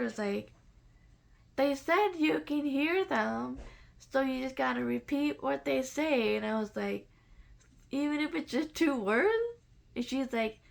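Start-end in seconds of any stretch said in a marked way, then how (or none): 1.6-6.4 higher, louder and slow coaching voice; urging teacher. Retold or imitated. Teacher telling her to repeat the spirits, urging. 7.9-9.3 higher, louder disbelieving ask; incredulous question. Retold or imitated. Asking the teacher about two words, incredulous.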